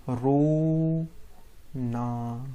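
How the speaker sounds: रोना stretched very slowly; slow, dragged-out रोना; drawn-out word. Shown as a demonstration. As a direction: draw रोना out long, like a wail.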